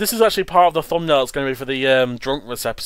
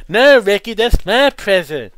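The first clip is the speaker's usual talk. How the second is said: put-on high whiny girl voice; 7 semitones higher, 7 dB louder, slower than usual. Voicing the cartoon characters.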